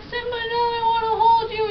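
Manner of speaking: a hint of hammed-up anguish; high mock plea. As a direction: beg the screen like a heartbroken viewer. Viewer voiced pleading.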